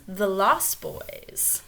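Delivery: usual speaking voice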